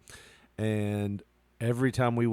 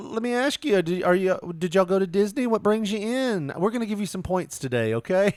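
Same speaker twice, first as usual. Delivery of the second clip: much higher, sing-song pitch; chirpy sales manager; 8 semitones higher than usual. Retold or imitated. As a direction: pester the family with a sugary sales voice. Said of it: "They're like".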